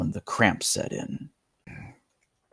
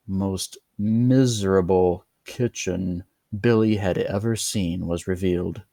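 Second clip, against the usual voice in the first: plain reading voice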